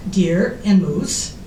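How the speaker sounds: normal talking voice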